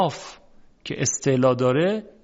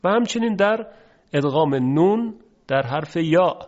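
ordinary delivery

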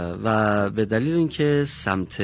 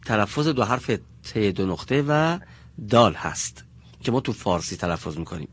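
ordinary delivery

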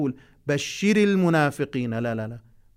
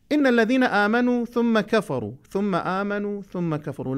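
Quran recitation; 6 semitones higher than usual. Acted. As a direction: recite each word deliberately for the class. Recites verse again.

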